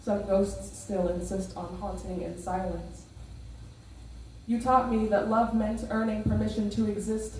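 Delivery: measured spoken word delivery; hushed raw poetry. Acted. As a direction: lay each line down deliberately. Performs spoken word poem.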